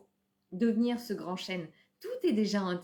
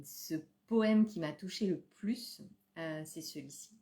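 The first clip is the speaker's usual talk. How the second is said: usual speaking voice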